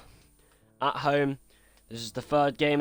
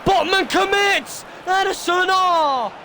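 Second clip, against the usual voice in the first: high, loud shouted commentary; excited save call; 8 dB louder, slower than usual. Acted. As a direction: yell as the keeper saves it.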